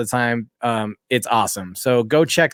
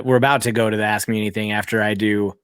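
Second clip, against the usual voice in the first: ordinary delivery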